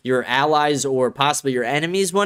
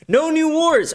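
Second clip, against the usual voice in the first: high, louder mocking squeal of a slogan; 13 semitones higher, 3 dB louder, slower than usual. Ranter mocks [name]'s no-wars promise, high mocking squeal. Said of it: "every one of his promises".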